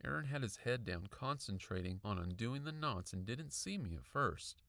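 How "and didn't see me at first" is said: usual speaking voice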